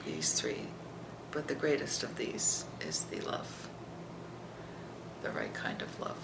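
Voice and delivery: awed hushed whisper. Real, not acted. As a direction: whisper it in awe. Whispered closing scripture.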